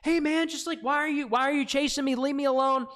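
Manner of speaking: high, whiny mock plea; whiny scared guy. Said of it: "But he's like".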